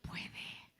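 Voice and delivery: one hushed whispered word. Real, not acted. Preacher quietly affirming God's power, whispered. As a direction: barely breathe it.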